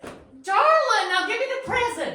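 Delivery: high, loud, rushed demand; snatch at it, fast and shrill; impatient. Acted. Impatient demand for her present.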